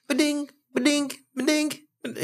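high sing-song ba-ding; notification chime. Retold or imitated. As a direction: chirp it like a phone alert. Imitating notification sounds.

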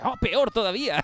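high, loud mock shock; mock dismay. Crew member reacting to the captain being English, mock horrified. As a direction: act appalled that he's English.

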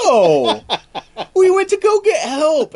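very high, laughing protest; mock innocent denial. Deserting crew to Columbus, high mock innocent denial. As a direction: protest in a squeaky, overdone voice. Voicing crew's guilty denial.

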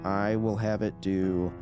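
ordinary delivery

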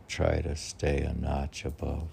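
ordinary delivery

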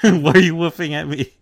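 high, loud baffled yelp; the monster dog shocked at being barked at; startled Cerberus. Retold or imitated. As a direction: Cerberus reacting to Luffy woofing, loud high bewildered cry. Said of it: "it's like".

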